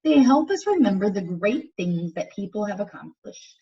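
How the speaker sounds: put-on clear, lively read-aloud for young listeners; reading to children. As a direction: read it out to the class like a picture book. Reads picture book to class.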